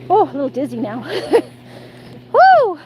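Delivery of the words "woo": high loud whoop; excited whoop. Real, not acted. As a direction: let out a happy woo. Loud cry during dizzy moment.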